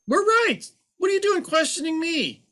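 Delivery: haughty accountant scoffing at a client; arrogant CPA. Acted. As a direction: play the offended expert who can't be questioned. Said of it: "So explain arrogance for us".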